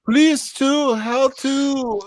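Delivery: very high, loud caricature voice; hammed-up halting foreign caller; Pedro. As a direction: squeal it in a comic put-on accent. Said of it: "That was Pedro".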